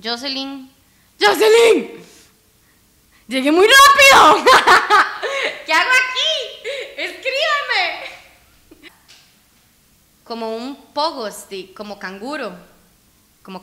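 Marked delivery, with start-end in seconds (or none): 1.2-2.0 high, loud shouted name; alarmed call. Real, not acted. Player calling Jocelyn for help, alarmed shout. 3.3-4.4 high, loud yell breaking into laughter; laughing panic. Real, not acted. Player telling Jocelyn they arrived too fast, laughing panic. 5.6-8.9 very high, squealed through laughter; laughing desperate plea. Real, not acted. Player begging Jocelyn to write what to do, laughing panic.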